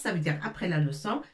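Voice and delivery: normal talking voice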